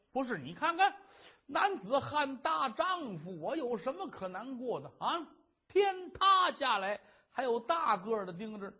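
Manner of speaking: boastful husband. Acted. Husband assuring his wife he is a real man, hearty. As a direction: puff up and reassure her.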